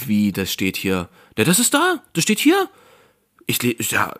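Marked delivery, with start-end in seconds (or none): 1.4-2.7 much higher, eager clerk insisting it is there. Retold or imitated. Clerk on the phone assuring the customer, eager and high. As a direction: chirp it out as obvious news.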